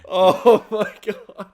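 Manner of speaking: slow high laughing gasp; laughing in disbelief. Real, not acted. Reacting, cracking up.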